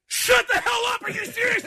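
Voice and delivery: very high squealed disbelief; stunned outburst. Retold or imitated. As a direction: shriek like a starstruck fan. Retold stunned reaction to star.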